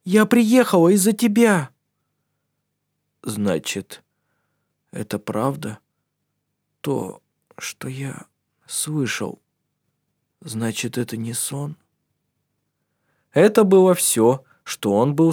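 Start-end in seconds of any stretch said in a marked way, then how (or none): 0.0-1.7 high, loud heartfelt cry; fervent exclamation. A narrator's reading. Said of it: "горячо воскликнула она". 3.2-11.8 faint, slow, breathy whisper; barely able to speak; weak whisper. A narrator's reading. Weak sick man speaks.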